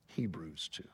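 breathy whisper of the citation; whispered reference. Real, not acted. Preacher names the scripture after the poem, whispered. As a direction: let it fall to a whisper.

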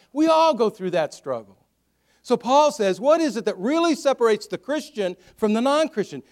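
loud, high, emphatic questioning; full-force preaching. Real, not acted.

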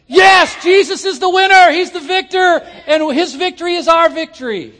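fan's jubilant cry for the victor; excited cheering. Retold or imitated. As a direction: shout it like the Buckeyes just scored. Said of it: "When you should be going".

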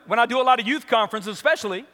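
normal talking voice

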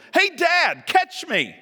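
high, loud call from above; child's yell. Acted. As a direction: holler down like an eager kid. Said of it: "above me yell".

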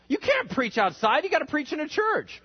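high-pitched scandalized protest; mock outrage. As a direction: imitate a shocked objector scolding the preacher. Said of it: "everyone thought that was sacrilege".

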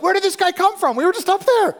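very high, loud mock disbelief; baffled shouting. Friends below baffled by a mystery stranger, shouted. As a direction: yell it in hammed-up bewilderment.

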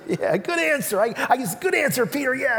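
lightly voiced high game-show praise; a hint of a game-show host; Family Feud cheer. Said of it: "on Family Feud".

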